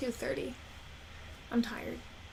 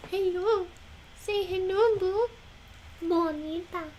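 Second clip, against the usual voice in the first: high squeaky pet greeting; 9 semitones higher, slower than usual. Real, not acted. Greeting the dog Bo with rising squealed hellos.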